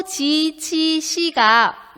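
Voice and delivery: teacher reading 지, 치, 시 one by one. Shown as a demonstration. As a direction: spell out 지, 치, 시 slowly for the class. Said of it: "읽고".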